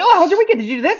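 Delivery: high, loud over-friendly chirp; mock chipper coworker. Mocking a chipper coworker asking everyone about their weekend, high loud chirp. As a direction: gush it like the office cheerleader. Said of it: "the super chipper".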